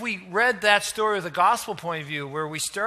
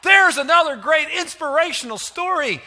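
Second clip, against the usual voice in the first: loud, much higher gushing mock excitement; mock enthusiasm; 8 semitones higher, 7 dB louder than usual. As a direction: mimic a naive listener's eager shout. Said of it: "And we say".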